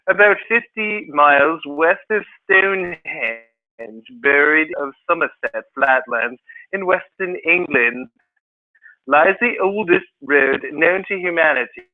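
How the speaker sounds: slow, put-on British accent, condescending. Shown as a demonstration. Teacher acting out condescending for the class, in a slow British accent. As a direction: read it in a sophisticated, fake British accent. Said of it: "let me read it with a British accent".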